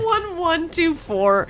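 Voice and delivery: high, laughing through the roll; laughing disbelief. Real, not acted. Player laughing at their own terrible dice roll, high pitched. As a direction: crack up reading out a bad roll. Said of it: "Oh, God".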